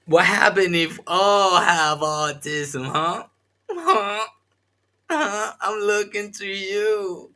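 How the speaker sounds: high mocking taunt, slow; escalating comedy rant. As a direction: tease them, pitch rising.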